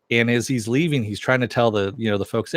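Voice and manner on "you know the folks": ordinary talk, nothing marked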